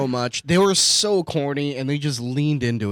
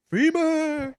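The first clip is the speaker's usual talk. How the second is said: laughing; 12 semitones higher, 4 dB quieter, much slower than usual. Retold or imitated. Re-enacting heckle while laughing.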